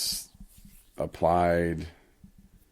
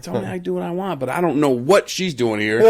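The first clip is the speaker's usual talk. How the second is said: higher, loud defensive pleading; flustered protest; 8 semitones higher, 7 dB louder than usual. Retold or imitated. [name] refusing his boss, higher and flustered. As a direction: protest that you won't go out. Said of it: "I'm like".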